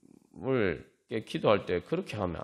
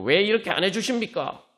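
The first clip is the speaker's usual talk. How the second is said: complaining prayer; 9 semitones higher, 5 dB louder than usual. Retold or imitated. Acted complaining prayer to Jesus.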